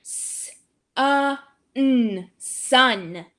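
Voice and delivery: "uh, n" stretched, then "sun"; teacher taps out a word; tapping out sounds. Shown as a demonstration. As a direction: segment the sounds, then blend. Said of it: "Let's tap out the word sun".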